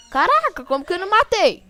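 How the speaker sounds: high, loud, fast disbelief; stunned shout. Real, not acted. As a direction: blurt it out in shock. Surprised he failed the kill.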